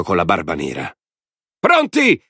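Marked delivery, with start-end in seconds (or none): 1.6-2.2 very high, loud gleeful yell; a mob of maniacs yelling together; shouted chorus cry. A narrator's reading. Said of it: "urlarono gli altri". (urlo acuto e forte)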